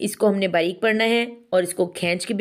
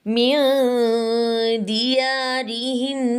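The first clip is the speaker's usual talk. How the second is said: slow, higher chanted recitation; tajweed recitation; 5 semitones higher, much slower than usual. Shown as a demonstration. Teacher recites the next phrase for students, slow chant. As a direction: chant each word, drawing out the vowels. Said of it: "तज़ाहरून".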